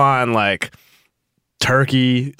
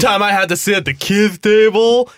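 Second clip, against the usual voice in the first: mock tragic whining; 9 semitones higher, 4 dB louder than usual. Mock complaint about mundane holidays.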